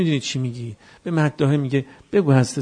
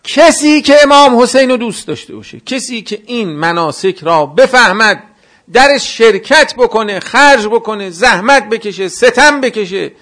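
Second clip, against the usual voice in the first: loud, higher impassioned sermon delivery; fervent preaching; 7 semitones higher, 7 dB louder than usual. Acted. Preacher rousing the mourners at the gathering, fervent and loud. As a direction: preach it out to the whole hall.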